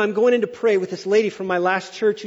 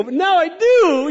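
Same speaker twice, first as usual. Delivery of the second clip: eager; 8 semitones higher, 6 dB louder, slower than usual. Retold or imitated. Joking enthusiastic reply.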